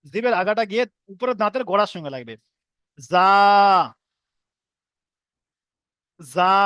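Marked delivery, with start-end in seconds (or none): 3.1-6.5 জা said with tongue tip at the roots of upper teeth; za with tongue at tooth roots. Shown as a demonstration. Teacher showing the জা sound. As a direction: clip জা firmly. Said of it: "ঠিক এরকম ভাবে উচ্চারণ করবেন".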